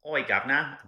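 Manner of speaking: loud, high mock Cockney; Cockney accent. Shown as a demonstration. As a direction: holler it like a hammed-up Cockney. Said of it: "Did you know the Cockney accent?".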